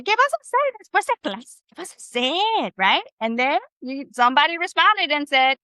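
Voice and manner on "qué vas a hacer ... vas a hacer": imitating a student. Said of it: "You said".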